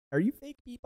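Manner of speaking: squeaky high mock imitation. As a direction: parody the question in a high squeak. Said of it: "I love he goes".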